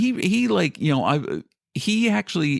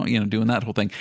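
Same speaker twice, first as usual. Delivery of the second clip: usual speaking voice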